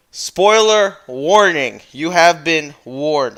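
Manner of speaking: slow, loud announcer-style warning; mock dramatic warning. Youtuber warning viewers, slow mock-dramatic boom. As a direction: boom it out like a trailer voice. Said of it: "I will give you a countdown".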